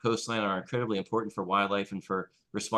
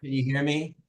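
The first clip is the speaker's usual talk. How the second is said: a little louder and higher; speaking up; 4 semitones higher than usual. Real, not acted. Speaker asked by the chair to speak up, a little louder. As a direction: raise your voice to check the line. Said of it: "speak up a little bit louder".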